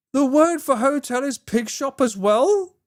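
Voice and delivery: high, slow question of disbelief; incredulous. Real, not acted. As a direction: stretch the question upward in amazement. Disbelief at the coincidence.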